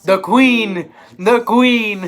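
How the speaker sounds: loud greeting call. Real, not acted. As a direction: hail her entrance. Calling out to arriving kid.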